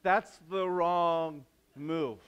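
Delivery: very slow, stretched-out admonition; drawn-out warning. Real, not acted. Pastor warning husbands before Father's Day, slow and drawn out. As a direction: drag out each word for comic emphasis.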